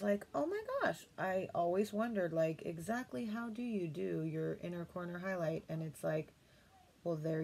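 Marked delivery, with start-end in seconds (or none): 0.3-1.1 a sudden high squeal of amazement; amazed oh my gosh. Retold or imitated. Remembering her discovery at five AM, squealing high and amazed. Amazed reaction to tutorial trick.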